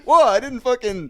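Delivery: loud, high protest of innocence; mock startled defence. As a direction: blurt it out, acting caught off guard. Acting out old man's startled defence.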